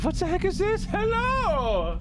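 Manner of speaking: very high, startled cry; startled outburst. Real, not acted. Streamer meets something unexpected mid-stream, startled outburst. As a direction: blurt it out high, stretching the hello.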